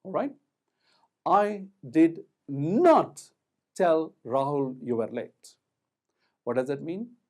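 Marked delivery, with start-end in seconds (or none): 1.2-5.6 slow build to a loud, stretched "not"; stress on NOT. Shown as a demonstration. Teacher showing the negative emphasised, stress on "not". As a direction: draw out "not" loudly, then pause. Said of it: "placing the emphasis on the negative or the not".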